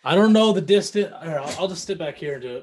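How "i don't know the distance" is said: loud, higher, too close to mic; yelling into the mic. Real, not acted. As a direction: call it out right into the mic. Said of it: "Just don't yell".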